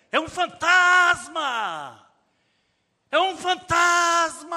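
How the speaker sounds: high, loud, drawn-out cry of "fantasma"; terrified scream. Acted. Preacher voicing the terrified disciples, screaming in fear. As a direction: scream it like you've seen a ghost.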